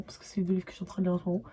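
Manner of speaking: fast hushed aside, almost under the breath; a quick secret aside to the viewers; whispered aside. Real, not acted. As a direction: drop into a quick whisper as a side note.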